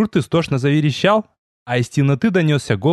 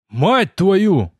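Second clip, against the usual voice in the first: startled bark; 6 semitones higher than usual. Acted. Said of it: "изумлённо рявкнул я".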